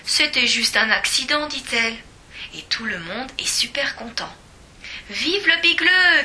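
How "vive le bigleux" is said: loud, slow high cheer; a hearty loud chant. A narrator's reading. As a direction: cheer it like a crowd. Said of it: "crient tous mes copains".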